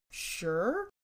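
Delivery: puzzled, questioning agreement; mildly baffled mom's consent; perplexed. Retold or imitated. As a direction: say yes as if unsure why, rising at the end. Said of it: "she was a bit perplexed".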